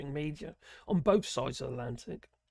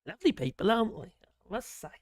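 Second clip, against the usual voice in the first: mock-admiring, pitched up at the start; sarcastic compliment, higher opening then dropping off; 5 semitones higher, 4 dB louder than usual. Real, not acted. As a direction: deliver fake praise with dry sarcasm. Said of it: "That's sarcasm, by the way".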